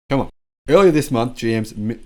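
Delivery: ordinary delivery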